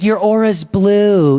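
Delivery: slow dreamy hippie chick impression; mock hippie saying your aura's blue. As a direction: drawl it out like a spacey hippie. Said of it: "when a hippie chick is like".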